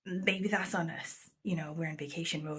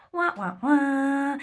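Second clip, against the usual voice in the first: high, loud, drawn-out wah-wahs; sad trombone imitation; 7 semitones higher, 8 dB louder, much slower than usual. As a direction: mimic a comic failure horn, last note held. Sad trombone after castle is closed.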